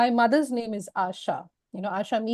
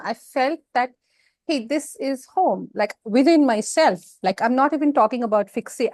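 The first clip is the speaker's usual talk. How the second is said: ordinary talk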